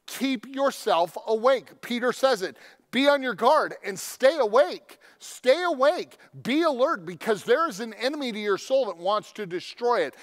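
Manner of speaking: fiery preaching. Acted. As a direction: rouse the congregation to stay alert. Preaching climax.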